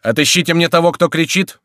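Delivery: high, loud military order; commanding. A narrator's reading. Crillon ordering guards to find the shouter, commanding. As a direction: order the men firmly. Officer orders his men to shoot.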